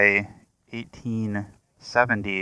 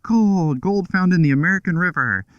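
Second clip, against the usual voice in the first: mock town-crier yell, higher; imitated street yell; 7 semitones higher than usual. Man spreading word of gold through the streets, mock yelling. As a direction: call it out like news to a whole street. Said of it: "walks around the streets just yelling".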